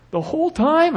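put-on high incredulous kid voice; whiny kid. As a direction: pitch way up like a whining boy. Son whining about smiling.

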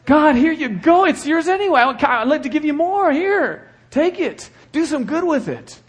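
high, eager offering voice; cheerful giver praying to God, high and bright; joyful giver. Pretend. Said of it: "This is the way we should give".

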